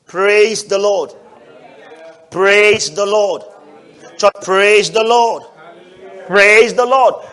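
loud, slow call to the church; call to praise. Real, not acted. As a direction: rouse the congregation, drawn out. Preacher calls the congregation.

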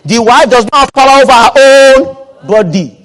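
loud, high-pitched sermon proclamation; forceful preaching. Real, not acted. Preacher declaring scripture to the congregation, forceful preaching. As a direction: thunder the verse to the church.

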